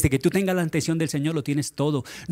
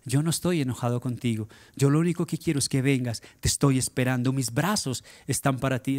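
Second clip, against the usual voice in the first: much softer, lower, gentle welcoming; tender voice of God; 5 semitones lower, 5 dB quieter than usual. Acted. Preacher voicing God to a sinner, soft tender welcome. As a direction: speak as a loving father calling back a child, lightly voiced. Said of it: "El amor de Dios es el que te dice".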